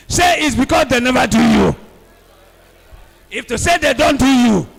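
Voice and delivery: very loud, rousing chant; loud preaching call and response; loud call to repeat. Real, not acted. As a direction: drive the congregation to shout it back. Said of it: "Everyone repeat".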